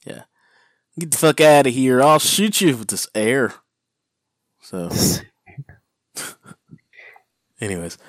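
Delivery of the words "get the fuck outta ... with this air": mock threat. Acted threat to an intruder.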